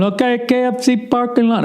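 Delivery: high, mock driver voice; driver's voice. Car service driver insisting on phone, high mock voice. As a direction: squeak it like the clueless driver.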